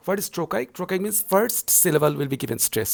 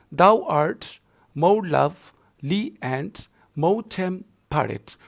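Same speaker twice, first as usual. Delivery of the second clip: very slow feet, "Thou art" with weight on "art", "more lovely" on "love"; drawn-out metrical beats, leaning on "art" and the second "more"; stress on every second syllable; 2 semitones higher, 4 dB louder, much slower than usual. Shown as a demonstration. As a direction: scan it foot by foot, pausing after "art" and each following pair. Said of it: "So art will be given stress".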